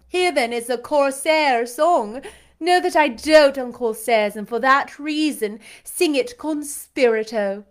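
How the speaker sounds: haughty lady commanding a singer; imperious belle. A narrator's reading. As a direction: order him about like a mock queen. Blanche announcing the song.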